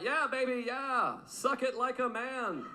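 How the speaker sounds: trying to sound sexy, awkwardly; mock dirty talk. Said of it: "This is what I said".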